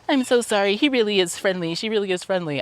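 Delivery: high, sing-song apology to a stranger; imitating the overly apologetic dog owners; mock apologetic owner. Mocking the owners who say sorry, high and sing-song. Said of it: "they're like".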